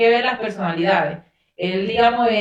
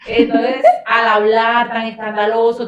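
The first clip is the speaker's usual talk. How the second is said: ordinary talk, nothing marked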